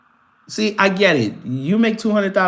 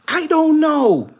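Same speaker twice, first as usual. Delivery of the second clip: drawn-out exasperation; 10 semitones higher, 3 dB louder, slower than usual. Real, not acted. Exasperated about the commenter.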